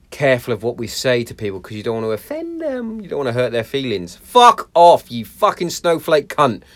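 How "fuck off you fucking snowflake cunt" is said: loud angry snap at a snowflake; swearing tirade. Pretend. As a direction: spit the insult out loud and slow. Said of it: "That's what I should say".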